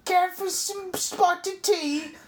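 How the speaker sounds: very high, hammed-up British accent; silly British accent, drawn out for comedy; mock British accent. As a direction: caricature a British lady in a squeaky-high voice. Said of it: "later she sounds British".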